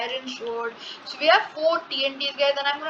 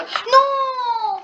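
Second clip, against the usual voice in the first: anguished cry; 11 semitones higher, 7 dB louder than usual. Real, not acted. Failed to place TNT.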